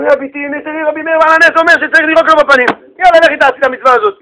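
high, hurried urging; excited neighbours. Acted. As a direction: rush your friend to go do it now. Excited neighbours urge wife.

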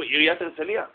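normal talking voice

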